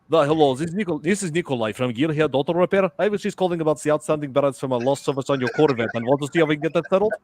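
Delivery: businesslike fake Russian accent; Russian prank-call voice. Nikolai from auto repair calling about a bill, Russian accent. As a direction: play a Russian mechanic phoning a customer. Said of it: "I used to use that Russian voice in prank calls".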